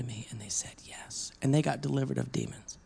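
hushed whisper. Real, not acted. Whispered climax of deliverance story.

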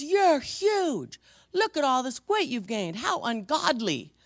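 a hint of a high, scolding busybody; mildly mocking high judgmental tone; rude lady. Rude woman scolding about weight.

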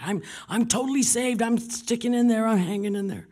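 high, loud, hammed-up enthusiasm; imitated eager assurance. Saved woman insisting she's still in, imitated loud excitement. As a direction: belt it out high, like an overeager convert.

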